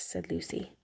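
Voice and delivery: usual speaking voice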